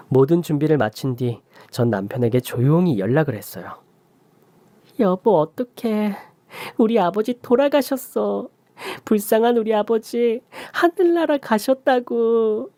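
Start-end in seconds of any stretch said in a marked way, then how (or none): 5.0-12.7 much higher, slow, put-on wailing; fake sobbing. Wife calling her husband with faked grief, slow and wailing. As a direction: fake grief to fool him. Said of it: "전 연기를 이어갔습니다".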